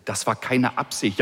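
usual speaking voice

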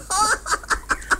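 high airy giggling burst; a quick high unvoiced giggle; breathless giggle. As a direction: giggle under your breath. Dog giggles at silliness.

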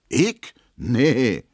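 outraged at the suggestion; indignant. Acted. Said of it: "sagt Emma empört". (Emma empört hoch und lauter)

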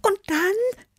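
very high, eager little cat; impatient kitten, high-pitched; urging. A narrator's reading. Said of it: "drängte Tibi".

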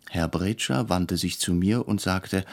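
plain reading voice

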